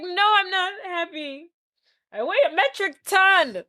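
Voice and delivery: high, lightly tearful mock lament; mock wail. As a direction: whine it as a wounded character. Acting Fat Bastard's unhappy confession.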